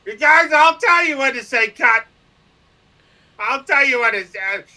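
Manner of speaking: put-on high, loud drunken whine; drunk rambling. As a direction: slur it like a wasted guest on air. Drunk on live show.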